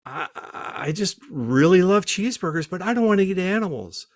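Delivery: high-pitched mock wife voice; imitating wife. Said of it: "she says".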